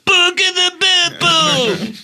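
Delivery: high, slow screaming. Retold or imitated. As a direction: scream the restaurant name in an exaggerated Italian accent. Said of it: "every time he'd go".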